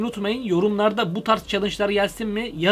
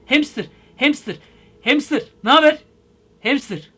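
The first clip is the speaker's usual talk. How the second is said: raised, higher-pitched calls; short, punchy greeting shouts; excited calling out; 6 semitones higher, 6 dB louder than usual. Real, not acted. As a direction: call out to get their attention. Calls out to hamster on chat.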